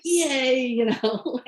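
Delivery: a pleased little retold yay; acted delight. Applicant reading her acceptance letter, acted delight. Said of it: "feeling really good".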